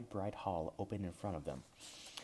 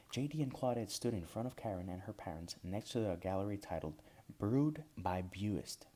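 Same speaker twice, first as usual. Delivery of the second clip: ordinary delivery, nothing marked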